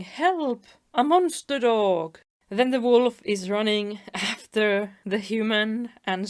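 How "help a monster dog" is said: high-pitched, louder storytelling cry; mock scream for help. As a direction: act out a panicked owner, half in earnest. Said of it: "the owner is just screaming".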